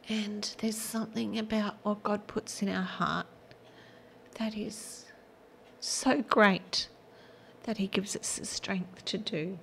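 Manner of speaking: soft tearful whisper; teary. Real, not acted. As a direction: voice almost gone, fighting back tears. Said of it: "I'm gonna get teary".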